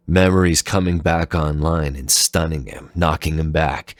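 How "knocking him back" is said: usual speaking voice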